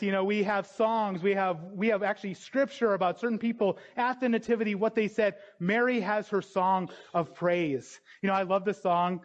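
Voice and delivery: ordinary talk, nothing marked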